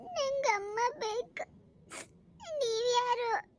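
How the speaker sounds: put-on high, slow, whiny little boy; crying child. Little boy wants his mother, crying. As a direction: wail slowly like a tearful child. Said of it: "ಯಾಕೆ ಅಳ್ತಿದ್ದಿ".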